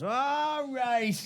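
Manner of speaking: high, drawn-out call-out; retold yell. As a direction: sing out high over a crowd. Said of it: "he yelled it out".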